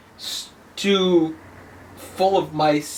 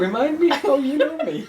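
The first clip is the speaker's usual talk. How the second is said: mock arrogant; 8 semitones higher than usual. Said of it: "somebody coming in the office".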